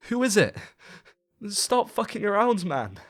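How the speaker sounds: scared shout through a closed door; yelling. Retold or imitated. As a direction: yell through the door at something outside. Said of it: "We keep yelling outside".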